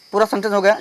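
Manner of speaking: normal talking voice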